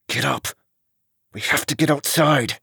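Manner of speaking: urgent order through gritted teeth. A narrator's reading. Jake orders his brother outside, through gritted teeth. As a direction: force it out between clenched teeth, without shouting. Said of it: "Jake announced through gritted teeth".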